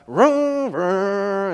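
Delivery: high, loud imitated engine roar; engine vroom. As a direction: rev it up with your voice. Imitating big motor starting.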